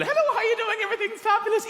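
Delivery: put-on high, lilting Irish accent; comically jovial, high-pitched Irish accent. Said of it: "it sounds very jovial".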